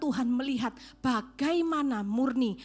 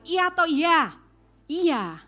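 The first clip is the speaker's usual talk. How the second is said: much louder and higher, pressing the question; loud challenge; 7 semitones higher, 9 dB louder, slower than usual. Acted. Preacher challenging the congregation, loud and high. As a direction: call it out to the whole room, higher.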